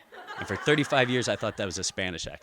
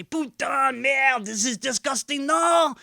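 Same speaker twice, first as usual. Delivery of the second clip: very high, loud put-on French accent, slow and outraged; exaggerated French accent, far higher; mock French accent; 14 semitones higher, 7 dB louder, slower than usual. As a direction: squeal the disgust in a hammy French accent. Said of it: "they sound exactly like the French".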